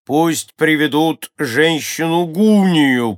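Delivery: loud, high, drawn-out command; commanding order. A narrator's reading. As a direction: order it out slowly and very loudly. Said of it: "приказал Клокнотон". (громкий, протяжный приказ)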